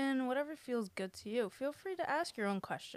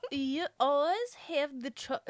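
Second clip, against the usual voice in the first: put-on European accent, loud; hammed-up accented lover voice; lover's accent; 3 semitones higher, 6 dB louder than usual. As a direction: begin the reading in a silly accent. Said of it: "we're back to who we are".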